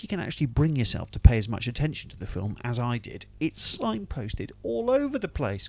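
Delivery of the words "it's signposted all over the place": sarcastic drawl. Acted. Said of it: "sarcastic drawn-out line".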